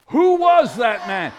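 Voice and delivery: loud, slow, projected question; big storybook wonder; dramatic question. Acted. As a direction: proclaim it with a stretched WHO. Rehearsed acted question with children.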